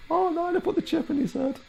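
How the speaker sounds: squeaky mock gasp, very high; mock high-pitched panic. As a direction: shriek it like a cartoon. Mocking character's reaction.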